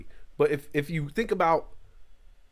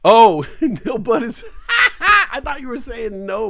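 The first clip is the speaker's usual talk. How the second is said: laughing; 7 semitones higher, 6 dB louder than usual. Real, not acted. Laughs at the backwards name.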